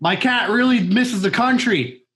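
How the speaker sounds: high-pitched director impression; David Lynch. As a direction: play the director giving a daily report, voice up high. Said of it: "actually a really good fucking impression".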